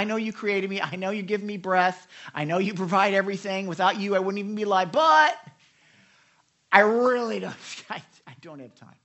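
put-on spoiled kid. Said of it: "sounds like a spoiled kid".

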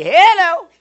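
loud, high startled hello; wake-up shout. Acted. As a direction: holler it to jolt sleepers awake. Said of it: "Anybody sleeping would be".

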